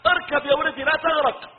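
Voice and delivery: acted urgent plea to a drowning child; pleading father. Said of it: "وأبوه يقول له". (نداء أب يتوسل إلى ولده)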